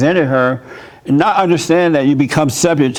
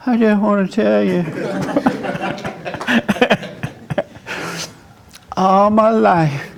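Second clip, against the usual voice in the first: higher, shaky-laughing "I just wanna tell you"; timid, nervous; 6 semitones higher, 4 dB quieter than usual. Shown as a demonstration. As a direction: squeak it out, scared to speak. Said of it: "I wasn't as strong. I'm more like".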